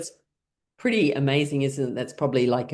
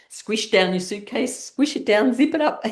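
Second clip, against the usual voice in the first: playful laughing voice; 8 semitones higher than usual. Shown as a demonstration. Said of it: "So let's pack our suitcases".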